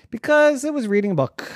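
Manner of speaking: fast, high, loud exclamation; excited punchline. A narrator's reading. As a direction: blurt out the silly reason.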